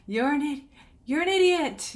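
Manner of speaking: high mock yell of an insult into a cave; the Grinch yells at his echo in the cave, mock shout. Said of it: "like, shouting to his echo".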